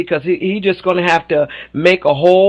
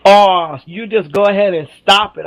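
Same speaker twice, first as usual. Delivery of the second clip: normal talking voice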